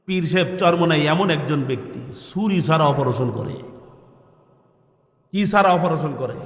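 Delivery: ordinary delivery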